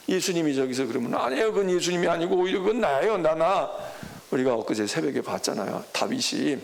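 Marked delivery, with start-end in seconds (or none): 1.1-3.7 fast, loud, insistent plea; urgent pleading disciple. Acted. Disciple begging Jesus to take him instead, urgent and loud. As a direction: rush to take the blame yourself.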